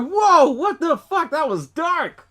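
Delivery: high, slow stunned whoa; shocked reaction. Retold or imitated. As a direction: react like it hit you hard. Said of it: "And I was like".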